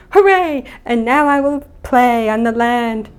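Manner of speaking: high, loud, over-eager cheer; mock cheering. Luca supposedly first on land, mock cheering. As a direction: declare it in hammed-up glee. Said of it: "he is not automatically like".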